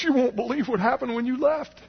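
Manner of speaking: put-on high-pitched excited old man; Bob. Old man greeting the returning helper with news, high excited voice. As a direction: burst out high, imitating the old man's amazement. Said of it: "He goes".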